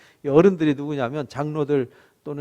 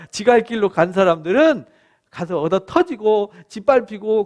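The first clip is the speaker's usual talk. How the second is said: ordinary delivery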